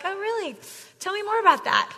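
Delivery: poker face. Shown as a demonstration. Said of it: "poker face".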